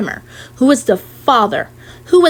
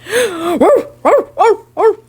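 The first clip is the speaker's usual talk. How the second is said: moaning rat barks; 7 semitones higher, slower than usual. Acted. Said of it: "the rat moaned".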